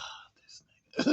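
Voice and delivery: slow, unimpressed whispered aside; dismissive whisper. Retold or imitated. Imitating the mother unbothered by the scene, slow dismissive whisper. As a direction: breathe it out like a mother rolling her eyes. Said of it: "the mom didn't give a fuck".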